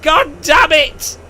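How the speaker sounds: high, slow, loud mock outrage; exasperated builders. Builders groaning at the pharaoh's demand, hammed-up angry shout. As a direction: drag it out in comic fury. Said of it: "they're like".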